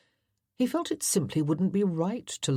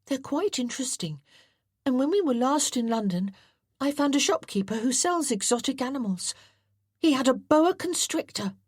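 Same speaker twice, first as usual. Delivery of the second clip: put-on a much higher, eager young boy's voice; an excited boy telling his hobby; eager boy John; 9 semitones higher than usual. John, eager boy, about snakes.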